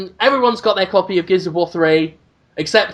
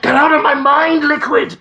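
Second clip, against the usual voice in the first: mock game villain cry; 6 semitones higher than usual. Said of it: "they're trying to get inside".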